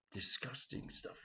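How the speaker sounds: very soft, slow, breathy half-whisper; hushed disgust. Retold or imitated. Lady recalls tripe.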